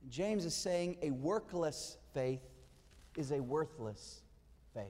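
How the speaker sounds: slow, weighted "workless" against "worthless"; slow emphatic point. Acted. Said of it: "it's also a metaphor of a faith".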